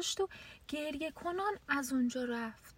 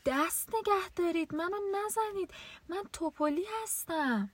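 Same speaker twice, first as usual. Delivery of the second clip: pleading cry; 7 semitones higher than usual. A narrator's reading. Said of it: "فریاد هیولا به آسمان بلند شد و داد زد".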